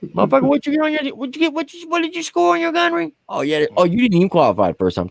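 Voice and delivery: squeaky high-pitched trash talk, fast; cocky high mock voice rubbing it in; mocking high taunt. Bragging to NCOs after gunnery.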